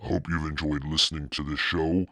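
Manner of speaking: plain reading voice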